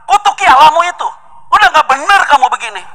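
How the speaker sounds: high, loud furious wife; angry wife. Acted. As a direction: spit out the curse at him. (istri marah berteriak, suara tinggi dan keras)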